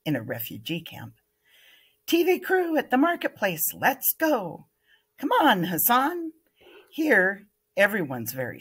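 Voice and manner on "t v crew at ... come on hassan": excited calling out. Acted. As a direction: call your friends over, eager. Kids calling out about TV crew.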